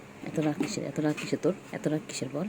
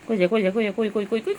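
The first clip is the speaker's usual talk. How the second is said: excited calling; 4 semitones higher, 5 dB louder than usual. Real, not acted.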